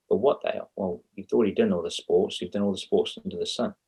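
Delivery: ordinary delivery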